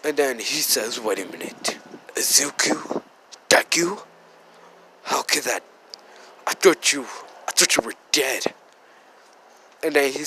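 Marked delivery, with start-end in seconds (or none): 1.0-8.5 hushed, halting disbelief; dumbfounded shock. Retold or imitated. Bakugo sees someone he thought was dead, stunned and hushed. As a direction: act mildly stunned, in broken phrases. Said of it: "automatic dumbfounded shock of his life".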